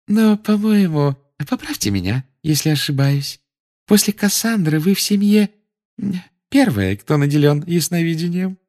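high, sweet prim official; sickly sweet inquisitor; sugary high Umbridge voice. A narrator's reading.

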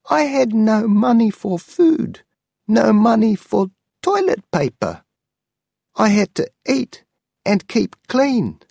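high, pleading old aunt, voiced well above the narrator; an elderly aunt begging her nephew not to be angry.

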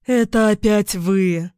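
slow, loud, vexed outburst; annoyed exclamation. Acted. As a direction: exclaim in irritation, dragging it out. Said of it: "досадливо воскликнула женщина". (досадливое восклицание)

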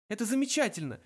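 high-pitched agitated sarcasm; high, strained indignation; choked protest. A narrator's reading. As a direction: protest as if grabbed by the neck. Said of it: "хрипел Артур".

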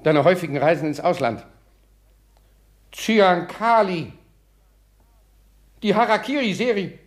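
piecing it together in dawning horror; horrified realization. Acted. Father realizes son is poisoner. (entsetzt, immer höher und lauter)

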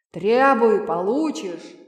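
slow, loud genie voice; booming thunder voice. A narrator's reading. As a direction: boom it out like thunder. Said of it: "голосом, подобным грому".

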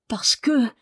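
held back, trailing into silence; hesitant. A narrator's reading. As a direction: hesitate on the edge of a secret. Marie hesitates before confiding secret.

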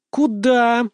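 sharp loud high bark. Acted. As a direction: snap out a sudden stop. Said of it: "рявкнула вдруг Света".

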